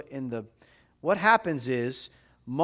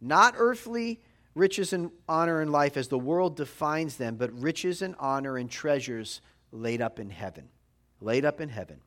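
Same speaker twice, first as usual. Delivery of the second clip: ordinary talk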